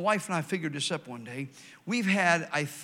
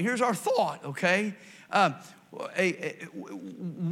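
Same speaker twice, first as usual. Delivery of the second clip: ordinary delivery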